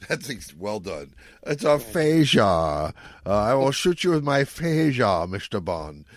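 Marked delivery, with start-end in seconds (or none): 1.4-6.0 louder, slow, grand villain threat; mock Bond villain. Host hams a Bond villain threatening with aphasia, slow louder menace. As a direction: gloat like a cartoon villain.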